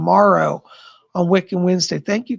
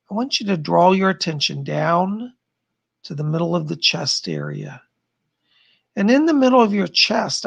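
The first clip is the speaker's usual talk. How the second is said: guided meditation; 2 semitones lower than usual. Acted. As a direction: ease listeners inward, unhurried and calm. Leads a blink meditation.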